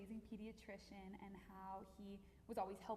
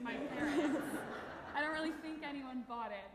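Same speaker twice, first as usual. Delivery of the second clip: louder words through real laughter; laughing while talking; 5 semitones higher, 9 dB louder than usual. Real, not acted. Granddaughter tells family about the fake salad photo, laughing. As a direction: talk through the giggles.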